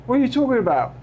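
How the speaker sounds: put-on high, fumbling, halting kid voice; a flustered schoolboy searching for words; nervous kid voice. Georgie replies to the player.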